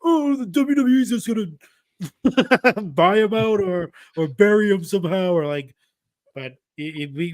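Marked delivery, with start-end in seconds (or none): 0.0-5.3 high, nasal-free mock whine of a fan; mock a whining fan; whiny fan voice. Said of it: "the fans gonna be like".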